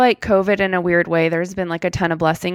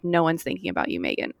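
quiet self-reminder; 10 dB quieter, faster than usual. Retold or imitated. Host reminding herself not to worry, quietly. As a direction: drop the voice as if talking yourself down.

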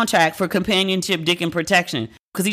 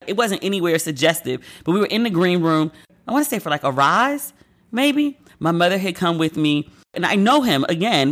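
ordinary delivery

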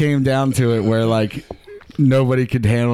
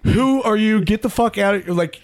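higher, startled mock shout of alarm; retold panicked yell; 8 semitones higher than usual. Waking to a stranger on top of him, higher panicked mock yell. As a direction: recoil as if woken by a stranger, half-yelled.

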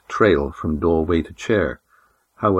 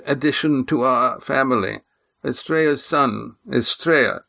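put-on formal old man; 8 semitones higher than usual. A narrator's reading. Old Oron welcomes the Silver Swan, formal. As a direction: greet her like a stiff elder.

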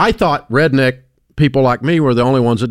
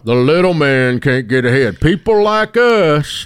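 mimicked complainer; 3 semitones higher, slower than usual. Host imitates people blaming the system, mock lament. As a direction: imitate a griper, stretching the last words. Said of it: "I heard that".

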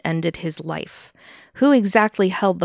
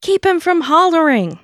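high, loud mock yell; acted scream; 10 semitones higher, 5 dB louder than usual. A narrator's reading. Narrator quoting Ethel as neighbors heard her, screaming. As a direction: holler it out like a panicked accomplice. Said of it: "Ethel was heard by neighbors screaming".

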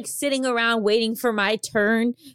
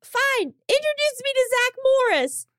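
high, pitched-up terms; haughty demand; 13 semitones higher than usual. Pretend. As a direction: set your price, lofty. Improvised breakup scene.

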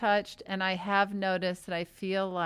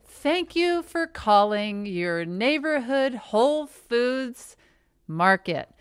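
upbeat hold message; 2 semitones higher, 5 dB louder than usual. Acted. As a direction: interrupt with an over-bright store announcement. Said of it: "they put you on hold and it's like".

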